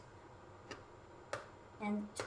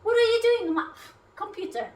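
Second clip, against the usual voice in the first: startled; 8 semitones higher, 8 dB louder than usual. Real, not acted. Fly on the screen.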